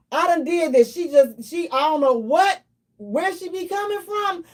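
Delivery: ordinary talk, nothing marked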